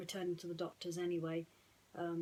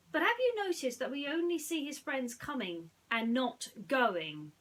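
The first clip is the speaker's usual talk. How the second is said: higher, loud, knowing question; Jasmine, pointed; 6 semitones higher, 7 dB louder than usual. Acted. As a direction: raise the catch with a raised eyebrow. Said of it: "Jasmine agreed".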